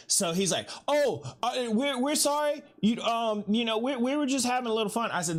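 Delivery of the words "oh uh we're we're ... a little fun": scared players. Retold or imitated. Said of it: "the one guy that they're scared of".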